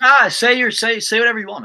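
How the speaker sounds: exasperated loud dismissal; loud brush-off. Real, not acted. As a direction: wave him off loudly, say what you want. Cohost waves off the argument.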